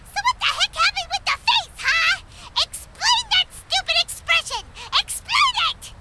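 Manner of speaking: high, indignant scolding; exasperated high-pitched outburst; outraged demand. Acted. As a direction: demand an explanation, pitch climbing. Outraged demand over the painted face.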